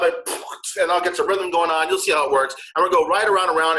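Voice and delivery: normal talking voice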